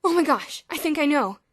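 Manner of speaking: loud, high gasping realisation; gasped. A narrator's reading. Boots gasping in mock realisation to George. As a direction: gasp it as if it just dawned on you. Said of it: "Boots gasped".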